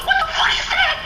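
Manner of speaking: very high squeal with laughter; flabbergasted. Acted. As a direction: shriek it in mock astonishment. Naruto acted seeing technology.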